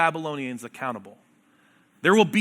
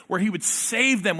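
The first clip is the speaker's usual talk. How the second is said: usual speaking voice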